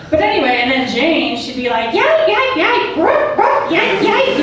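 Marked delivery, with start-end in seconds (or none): 1.9-4.4 much higher, louder yapping like a little dog; yapping chihuahua. Acted. Co-host Jane chiming in on air, high yappy chihuahua barks. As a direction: yap like a tiny chihuahua. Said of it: "such a little bat-faced chihuahua".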